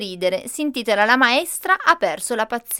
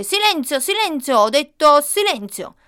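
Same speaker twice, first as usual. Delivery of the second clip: high, loud teacher's shout rising to a final blast on detto; shouting for silence; 5 semitones higher, 6 dB louder than usual. Acted. Teacher Laura shouting at the rowdy first graders, loud and high. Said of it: "si mise a gridare".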